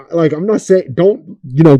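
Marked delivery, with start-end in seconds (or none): none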